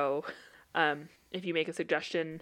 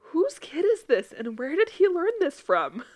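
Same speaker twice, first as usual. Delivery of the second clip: higher-pitched, stunned imitation of a relative; mock disbelief; 9 semitones higher, 4 dB louder than usual. Sister-in-law reacting to a swearing child, stunned disbelief.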